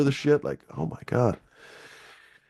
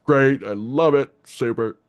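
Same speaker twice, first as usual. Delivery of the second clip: sarcastic mock praise; 4 semitones higher, 4 dB louder, slower than usual. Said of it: "everyone's like".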